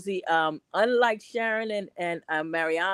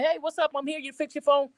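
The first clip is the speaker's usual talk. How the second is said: higher, relaxed street-style hello; casual street greeting; 7 semitones higher, faster than usual. Retold or imitated. Repairwoman greeting customer at door, casual street style. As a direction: loose and friendly, like a neighbor. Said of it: "if I say".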